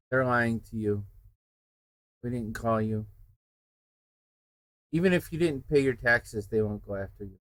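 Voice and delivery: usual speaking voice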